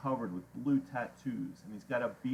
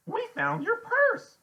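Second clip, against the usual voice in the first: very high, loud slow squeal; blurting out; 10 dB louder, slower than usual. A narrator's reading. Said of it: "Freak blurts out".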